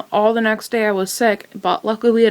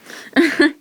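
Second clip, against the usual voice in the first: a short bright laugh; light giggle; 8 semitones higher than usual. Real, not acted. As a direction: laugh it off, a little embarrassed. Laughing at being in love.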